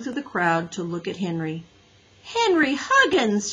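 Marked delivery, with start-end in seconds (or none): 2.2-3.5 high, slow shocked exclamation; high startled exclamation, drawn out; exclaiming. A narrator's reading. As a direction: exclaim his full name in shock. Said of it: "she exclaimed".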